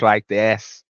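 a light imitation of a Boston accent; hint at a coach's Boston accent. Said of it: "he has a Boston accent".

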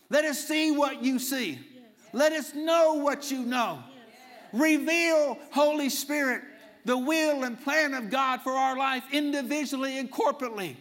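shouted prayer, high and loud; loud overlapping group prayer. Real, not acted. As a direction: cry it out to heaven.

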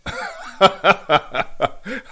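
real choked-up laugh; emotional laugh. Real, not acted. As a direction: laugh through welling tears. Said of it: "I'm just getting choked up again here".

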